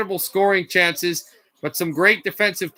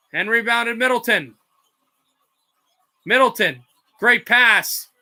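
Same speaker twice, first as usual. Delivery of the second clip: energetic basketball call; broadcast-style play-by-play; live play-by-play; 3 semitones higher than usual. Acted. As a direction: ride the action as it happens.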